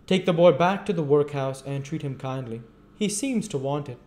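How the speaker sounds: high, loud voice of authority; stern old magistrate. A narrator's reading. Magistrate ordering the boy's return, stern and loud.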